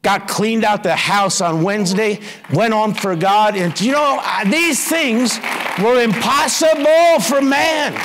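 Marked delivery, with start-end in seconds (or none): 3.6-8.0 rising to a fervent shout; preacher at full force; preaching shout. Real, not acted. Preacher building to a climax.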